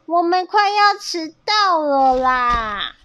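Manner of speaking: high, drawn-out storybook wail; fretful complaint. A narrator's reading. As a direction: whine slowly about running late. 鸭子担心大家迟到. (拉长声音着急地抱怨)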